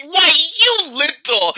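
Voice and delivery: high, slow mock-angry "why you little"; hammed-up furious old man; angry growl. Brother caught by the grocer, mock angry. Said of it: "And then he said".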